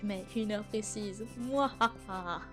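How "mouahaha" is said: slow, drawn-out mouahaha; evil laugh. Acted. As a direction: gloat in a long villain laugh. Villain laugh after death sentence. (rire diabolique)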